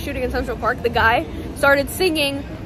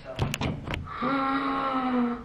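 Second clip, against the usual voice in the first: delighted gasp; 3 semitones higher, 10 dB quieter than usual. Real, not acted. Sees cute pajamas.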